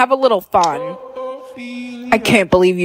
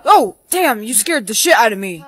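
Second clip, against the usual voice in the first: normal talking voice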